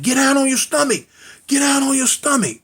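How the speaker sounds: high-pitched barked order, imitated; police command. Police to brother holding his sister, acted-out yelled order.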